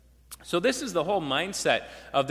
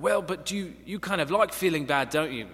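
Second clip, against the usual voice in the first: light British accent, imitating a rock star; a hint of an English accent, gently probing. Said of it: "[name] responds in his British accent".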